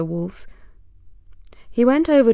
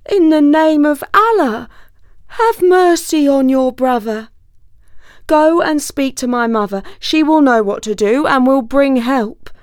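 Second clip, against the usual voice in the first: higher, louder, desperate plea; shocked pleading; 6 semitones higher, 5 dB louder than usual. Acted. Trapped wolf begging his brother fox, shocked and pleading. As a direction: cry out to be spared. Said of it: "Quite shocked, he replied".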